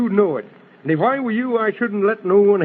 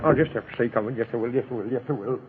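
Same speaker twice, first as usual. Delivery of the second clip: muttering to himself; 9 semitones lower, 8 dB quieter, much faster than usual. Acted. Frets to himself before the door.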